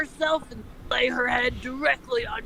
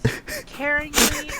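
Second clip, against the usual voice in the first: drawn out; 5 semitones higher, much slower than usual. Pretend. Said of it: "drawn-out reading".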